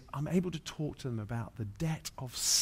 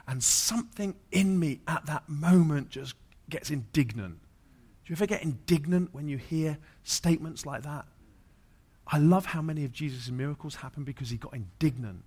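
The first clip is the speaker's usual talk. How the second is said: louder, higher rising preacher's fervour; impassioned preaching; 5 semitones higher, 6 dB louder than usual. Acted. Preacher urges the congregation to get indignant, loud and fervent.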